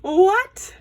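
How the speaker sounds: high, loud, incredulous "what?"; confused disbelief. Real, not acted. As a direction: burst out in stunned disbelief. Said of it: "I'm confused".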